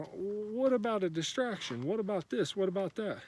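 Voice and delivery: high-pitched, pestering questions; mock fretting. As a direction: imitate someone fretting over every distraction. Mimics people distracted by others.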